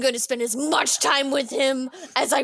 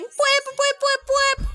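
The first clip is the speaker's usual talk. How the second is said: high-pitched repeated whoop; whooping alarm noise; 13 semitones higher, slower than usual. Acted. Guest celebrating sixty-nine sixty-nine, high whooping alarm noise. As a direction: imitate a party siren in high, even bursts. Clock hits sixty-nine, mimics party siren.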